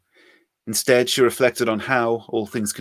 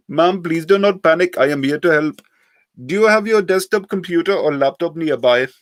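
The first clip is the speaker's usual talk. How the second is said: put-on high, loud scam-caller accent; heavy Indian accent; 8 semitones higher, 7 dB louder than usual. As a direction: play a pushy call-centre scammer. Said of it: "heavily accented voice".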